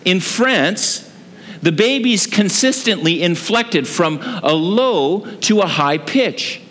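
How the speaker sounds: ordinary talk, nothing marked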